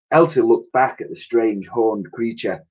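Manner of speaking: usual speaking voice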